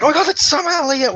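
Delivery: high, thrilled fan shout; excited squeal. Retold or imitated. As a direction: gush like a starstruck fan. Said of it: "and I'm like".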